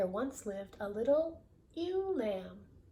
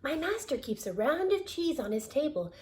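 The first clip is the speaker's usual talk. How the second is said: higher lamb voice; 6 semitones higher, 4 dB louder than usual. Acted. Lamb describing her master's cheese. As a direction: tell it like the lamb.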